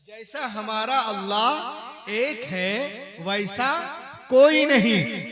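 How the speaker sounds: slow climactic pulpit shout; emphatic preaching repeat. Shown as a demonstration. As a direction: build each word up to a cry. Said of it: "repeat of previous line".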